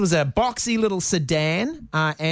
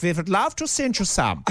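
put-on playful sing-song Swedish accent; light comic Swedish impression; mock Swedish accent. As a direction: quote them in a jokey Swedish accent. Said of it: "but the Swedish were like".

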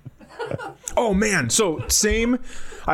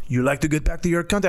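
French man voice; 3 semitones higher, faster than usual. Retold or imitated. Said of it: "And he goes".

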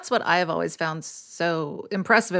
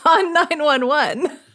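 laughing; 7 semitones higher, slower than usual. Real, not acted. Laughing while praising.

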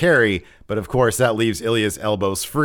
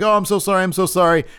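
frantic remorse; 10 semitones higher, faster than usual. Retold or imitated. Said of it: "starts freaking out in remorse".

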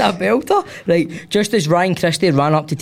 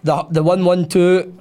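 ordinary talk

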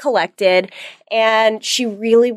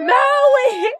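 sudden scream. Retold or imitated. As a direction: snap and scream the name at a kid. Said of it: "in her face".

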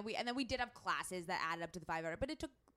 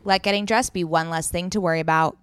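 loud, rounded-off slogan; ad read; 14 dB louder than usual. Real, not acted. Host signing off the sweater ad, loud ad read. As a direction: deliver it as the closing tagline.

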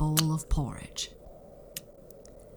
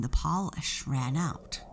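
usual speaking voice

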